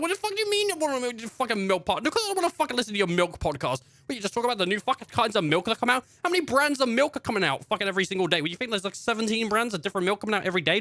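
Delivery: high, fast hammed-up fury; mock outraged rant. Shopper ranting at the milk podcast clerk, fast high mock rage.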